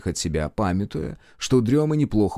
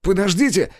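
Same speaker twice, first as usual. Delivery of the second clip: high calling shout; 10 semitones higher, 4 dB louder than usual. A narrator's reading. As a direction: call out to stop her. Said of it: "закричал Даниил".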